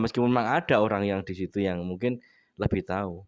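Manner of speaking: ordinary delivery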